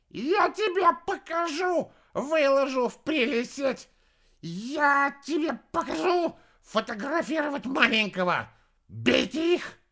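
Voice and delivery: high, loud furious shriek. A narrator's reading. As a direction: shriek it out in a rage. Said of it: "опомнился и завопил".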